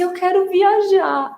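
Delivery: put-on slow, high child's wail; drawn-out pretend weepy plea; mock crying. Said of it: "não falei chorando como ela".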